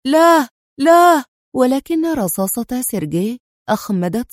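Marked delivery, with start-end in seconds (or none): 0.1-1.2 much higher, louder, slow screaming no; terrified scream. A narrator's reading. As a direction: scream for your life. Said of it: "صرخ جان في رعب".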